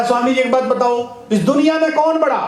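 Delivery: put-on high-pitched pestering drunk; drunkard's challenge. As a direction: put a high, insistent question as the drunk accosting a priest. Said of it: "एक शराबी शराब पी लिया था".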